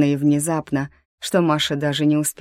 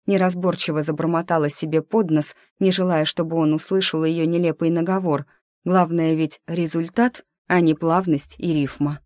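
plain reading voice

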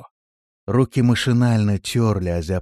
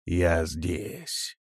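hushed, ominous, softer; quiet sinister voice; 4 semitones lower, 6 dB quieter than usual. Acted. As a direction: breathe it from the dark. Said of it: "раздался тихий, но зловещий голос". (тихий зловещий голос)

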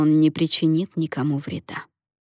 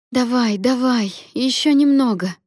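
urgent cry; 6 semitones higher, 4 dB louder than usual. A narrator's reading. Mary urges on a woman in labour, crying out. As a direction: cry out encouragement to push on. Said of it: "кричала Мэри".

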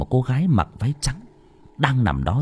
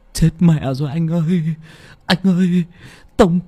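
higher, stammering wail on the edge of crying; whimpering, near tears; 8 semitones higher, 4 dB louder than usual. A narrator's reading. Assistant whimpering to his boss about hitting someone, tearful stammer. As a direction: blubber it out in panic. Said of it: "bước xuống xe mà mếu máo".

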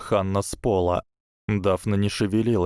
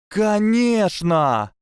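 drawn out very high and louder, very slow; delighted exclaim; 13 semitones higher, 5 dB louder, much slower than usual. A narrator's reading. Lavender jumping up with joy, high delighted squeal. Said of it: "воскликнула Лаванда".

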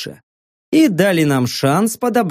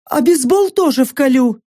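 mocking mercenary woman; 9 semitones higher than usual. Saka's voice.

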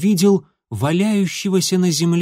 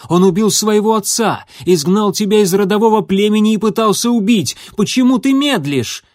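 high, loud and urgent; shout; 8 semitones higher, 6 dB louder than usual. A narrator's reading. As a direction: press her hard, yelling. Fireheart keeps shouting at healer.

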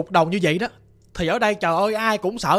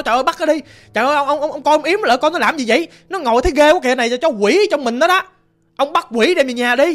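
raised, louder, urgent cries; acted alarmed hollering; 5 semitones higher, 4 dB louder than usual. Brother begging the master to catch the demon, acted hollering. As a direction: call out in alarm to the exorcist. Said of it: "anh hai tôi ổng mới la làng".

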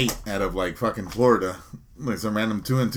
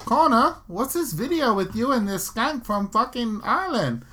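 high-pitched, badly faked Irish-type accent; bad accent impression; 12 semitones higher than usual. Imagined confrontation with Connor over a video, badly faked high Irish accent. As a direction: put on a squeaky, unsure Irish accent. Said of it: "I don't know how to do the accent".

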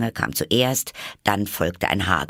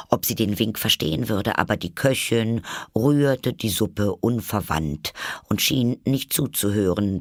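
ordinary delivery, nothing marked

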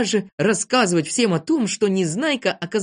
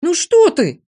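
high, loud objection; shrill-pitched indignant outcry; indignant protest; 12 semitones higher, 7 dB louder, slower than usual. A narrator's reading. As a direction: protest in disbelief. Тюбик protests against wrecking the tent.

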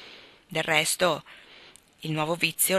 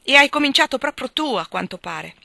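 loud, higher cutting retort; angry accusation; 7 semitones higher, 7 dB louder than usual. A narrator's reading. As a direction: throw the blame back at him.

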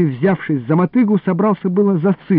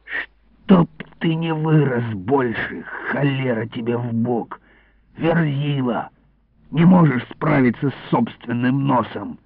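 hushed, drawn-out angry grumble; angry scolding; 3 semitones lower, 4 dB quieter than usual. A narrator's reading. Iliko to the boy who sneezed, angry scolding. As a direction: scold under your breath, crossly. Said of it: "рассердился Илико".

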